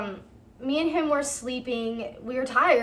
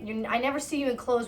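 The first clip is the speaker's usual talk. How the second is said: normal talking voice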